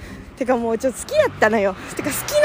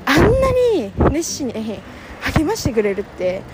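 ordinary talk

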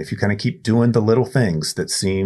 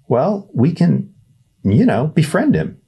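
put-on high, fast, hopeful kid voice; a sweet naive boy pushing his idea; eager boy Ernest; 11 semitones higher, 4 dB louder than usual. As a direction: Ernest urging Ryan to help. Ernest suggests befriending.

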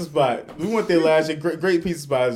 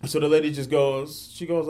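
ordinary delivery

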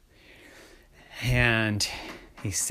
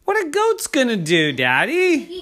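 put-on high child's voice, pitched up in disbelief; incredulous child; 12 semitones higher, 4 dB louder than usual. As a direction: question Daddy's silly idea. Kid doubts dad's goat idea.

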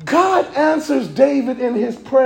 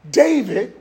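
ordinary talk, nothing marked

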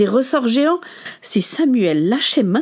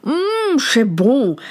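savoring; much slower than usual. A narrator's reading. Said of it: "avec un large sourire dit".

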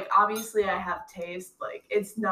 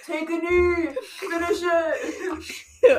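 high, hammed-up frat bro chant; 8 semitones higher, 3 dB louder, much slower than usual. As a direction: egg her on like a frat bro at a party. Frat-style cheering on drinker.

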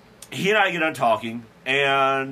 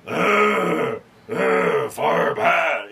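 a louder, lumbering monster voice, pitched up; Frankenstein impression; 3 semitones higher, 5 dB louder, much slower than usual. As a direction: grunt it out like a dim movie monster. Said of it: "I sound like Frankenstein".

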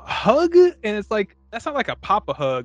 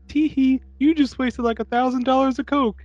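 put-on much higher, teasing girly voice; mock giggly woman; 10 semitones higher than usual. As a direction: play the giggling rich girl, high.

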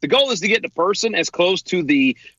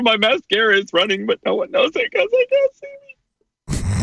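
snotty crying; 6 semitones higher, faster than usual. Pretend. Still mock crying.

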